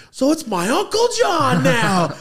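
high, loud, drawn-out cheer; jubilant shout. Retold or imitated. Kid after his aunt gets proposed to, jubilant shout. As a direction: belt it out slowly like a thrilled kid.

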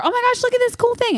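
luring excitement. Retold or imitated. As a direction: tempt a hiding child out with excitement. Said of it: "trying to get him".

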